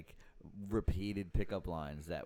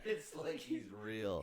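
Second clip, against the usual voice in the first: mock echo; 12 semitones higher, 6 dB quieter, slower than usual. Cohost echoes line, mocking.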